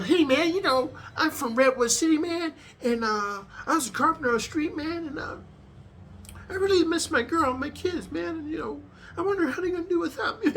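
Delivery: soft whiny inmate. Retold or imitated. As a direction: play the weak new guy pouring out his heart. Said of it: "You start doing like that".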